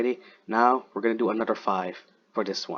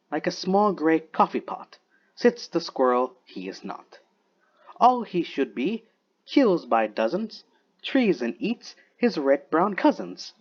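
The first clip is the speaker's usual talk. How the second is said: higher-pitched, lilting verse recital; measured poetry recitation with raised pitch; poem reading; 5 semitones higher, slower than usual. Acted. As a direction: read the verse aloud, pausing at phrase ends. Reads Wolfe's poem aloud.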